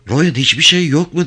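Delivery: yüksek perdeden telaşlı sesleniş; bağırarak seslenme. A narrator's reading. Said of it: "diye bağırdı". (yüksek sesle seslenerek)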